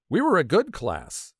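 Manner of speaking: plain reading voice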